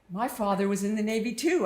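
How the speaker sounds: ordinary delivery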